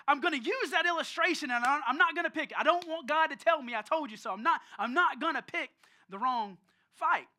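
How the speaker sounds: acting out a determined listener; emphatic vow. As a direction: declare it loudly like a firm promise.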